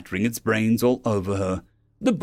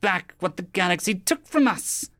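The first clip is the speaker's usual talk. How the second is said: high-pitched, mildly screamed rant; strained, high alien anger, not full volume; 8 semitones higher than usual. A narrator's reading. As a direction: spit out a grievance as the alien. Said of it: "Kraid screamed".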